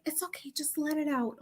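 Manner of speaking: soft, high comforting voice; mock-therapist reassurance, hushed and high; imitating soothing therapist. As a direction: gently coax in a put-on hush. Voicing therapist comforting the client.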